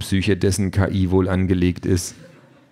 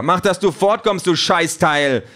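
high, loud, furious yell; angry shout; 11 semitones higher, 4 dB louder than usual. A narrator's reading. Peter chases the delivery drone away, yelling furiously. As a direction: yell abuse to drive it away. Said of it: "schreit Peter".

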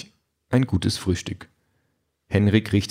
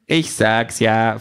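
slow, drawn-out machine voice; know-it-all talking gadget; smug gadget voice; 3 semitones higher, 3 dB louder, much slower than usual. Acted. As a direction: savour every word like a proud device. Pink the Quality Pad speaking.

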